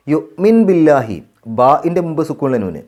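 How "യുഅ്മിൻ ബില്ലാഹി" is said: slow, drawn-out chanted recitation; measured Quranic chant. Shown as a demonstration. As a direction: recite it slowly with tajweed.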